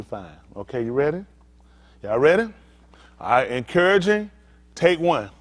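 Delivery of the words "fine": soft demo. Shown as a demonstration. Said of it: "she gonna tell you".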